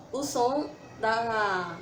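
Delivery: ordinary talk, nothing marked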